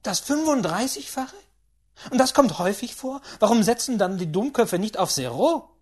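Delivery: put-on high, loud old lady pressing with questions; astonished, impatient great-aunt; excitable great-aunt.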